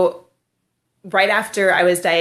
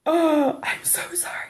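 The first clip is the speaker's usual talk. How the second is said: unvoiced whisper, imitating an embarrassed mom; hushed apology; 10 semitones higher, 4 dB quieter than usual. Mother to the bald shopper about her son, whispered apology. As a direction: mouth a mortified sorry in a whisper. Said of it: "the mom's look was just like".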